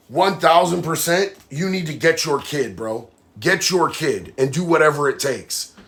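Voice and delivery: usual speaking voice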